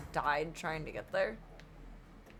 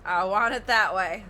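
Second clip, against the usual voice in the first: louder repeat; 8 dB louder than usual. Real, not acted. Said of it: "repeats the bride's line".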